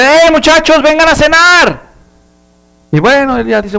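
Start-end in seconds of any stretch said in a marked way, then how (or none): 0.0-1.8 high, loud call across the yard. Retold or imitated. Grandma calling the kids in for dinner, loud high call. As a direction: shout them in for dinner. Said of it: "les grita".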